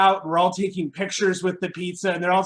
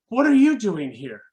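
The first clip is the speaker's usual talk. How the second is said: lightly acted mom, higher pitch; friend's mom; 5 semitones higher, 4 dB louder than usual. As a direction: imitate a mom confronting a visitor. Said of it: "John's mom appears in front of me".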